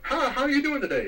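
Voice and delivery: bright, high clerk greeting; customer service greeting. Retold or imitated. Clerk greeting a shopper, high and cheery. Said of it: "customer service right there".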